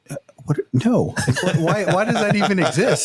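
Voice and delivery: high, laughing disbelief at a silly offer; incredulous refusal. Retold or imitated. Friend offers snowshoeing, he laughs off the idea in disbelief. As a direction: squeak out a laughing no way.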